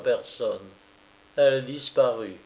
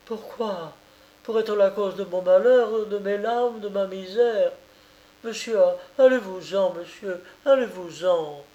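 put-on higher, pleading young woman, close to tears; lightly voiced peasant woman; 7 semitones higher than usual. A narrator's reading. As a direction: beg him to leave, voice a touch higher. Oulana pleads with Thaddée.

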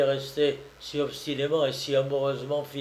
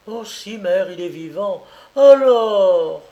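higher, breaking into a loud cry on Alors; despairing daughter; 5 semitones higher, 9 dB louder, slower than usual. Acted. Daughter telling her mother he lives, ending in a loud cry. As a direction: despair bursting out at the end.

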